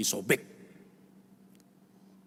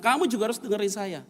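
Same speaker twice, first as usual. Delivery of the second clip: raised retold argument; 8 semitones higher than usual. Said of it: "dengan suara-suara yang kuat, keras".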